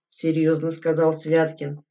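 ordinary delivery

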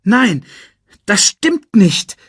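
high, loud denial; insistent protest. A narrator's reading. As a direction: insist passionately, loudly. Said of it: "beharrte Simba". (laut und hoch beharren)

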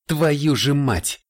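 sharp, slow high exclamation; exclaiming. Acted. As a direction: cry it out in shock. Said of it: "воскликнул Ларри".

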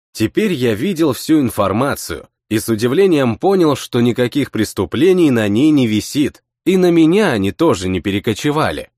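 plain reading voice

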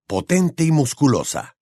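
usual speaking voice

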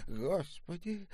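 high, soft, slow moan; weak moan. A narrator's reading. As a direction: moan it faintly, helplessly. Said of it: "простонала старушка".

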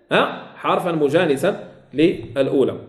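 ordinary talk, nothing marked

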